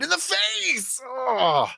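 high-pitched wince into a drawn-out "oh"; shocked reaction. Retold or imitated. Host reliving the moment she was hit, high shocked cry. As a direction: keep the cry going, high and aghast.

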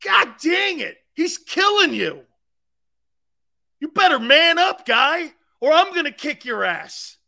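high, loud angry holler; hollering at teammate. Acted. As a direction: chew him out across the field. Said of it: "you don't need to holler at me".

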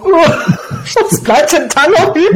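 half-laughing mock dismay, pitch up. Recalling teachers' advice to the interviewer, amused mock dismay. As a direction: play the flustered pupil, giggling. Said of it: "Und ich so".

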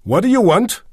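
high, loud brusque challenge; snapping. A narrator's reading. Receptionist confronting the newcomer in the entrance hall, snapping. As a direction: snap at him, loud and resolute. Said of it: "schnauzte ihm eine resolut dreinblickende Endfünfzigerin entgegen".

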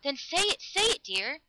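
high, insistent begging; urgent plea. A narrator's reading. Lover begging the girl to say his name, urgently. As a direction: beg harder on the repeat.

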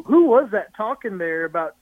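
ordinary talk, nothing marked

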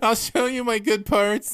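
high mock-seductive fan voice; creepy come-on. Joking come-on to the singer, creepy and high. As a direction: offer it up high, like a creepy admirer. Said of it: "it sounds creepy, I guess, when you say it like that".

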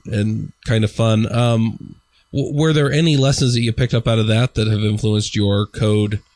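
usual speaking voice

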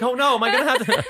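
high, laughing fake worry; mock panic through laughter. Retelling friend's tax panic.

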